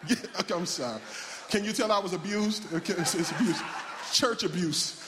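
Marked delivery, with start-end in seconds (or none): 1.5-5.0 amused mock complaint, breaking into laughs; laughing. Real, not acted. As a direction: joke through real laughter. Joking about own church upbringing, laughing.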